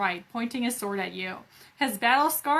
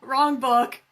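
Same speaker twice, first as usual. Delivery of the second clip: louder, laughing at own mistake; 6 semitones higher, 6 dB louder than usual. Real, not acted. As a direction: crack up at the slip. Correcting own joke, laughing.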